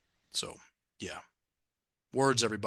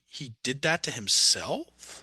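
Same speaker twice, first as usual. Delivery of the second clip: stuttered, lifting at the end like a question; rising like a question; 2 semitones higher, slower than usual. Retold or imitated. Woman in workshop doubts the new sentence, rising questioning tone. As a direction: stammer it out as a question, slowly. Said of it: "it went up at the end like a question".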